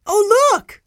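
put-on very high, loud little-boy exclamation; high child's squeal of words; excited child's cry. A narrator's reading. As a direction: cry out in delighted discovery. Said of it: "cried Benny".

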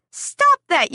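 high sharp yell to shoo a rooster; shout at the rooster. Acted. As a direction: call out high to stop it. Chasing rooster from dog food.

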